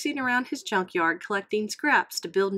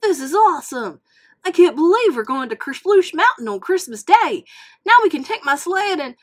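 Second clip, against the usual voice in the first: excited; 7 semitones higher, 10 dB louder than usual. Acted. Mater thrilled about trip.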